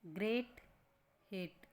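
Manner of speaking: ordinary delivery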